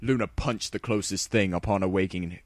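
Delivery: ordinary delivery, nothing marked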